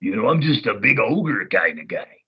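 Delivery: slow, dopey big-oaf voice; dumb ogre voice. Shown as a demonstration. Voice actor showing the replacement's dummier Ox King, slow oaf voice. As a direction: play it as a dim, lumbering ogre. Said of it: "added a little dumminess to the voice".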